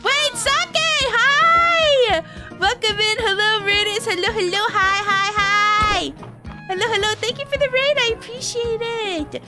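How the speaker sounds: high, bright greeting voice; bubbly squeaky welcome; cute anime streamer. Real, not acted.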